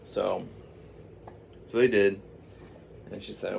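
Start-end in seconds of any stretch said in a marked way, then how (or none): none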